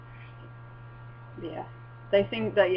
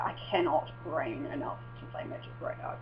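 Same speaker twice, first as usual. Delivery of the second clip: exhausted near-whisper; 4 semitones lower, 9 dB quieter than usual. Retold or imitated. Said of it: "I was just like".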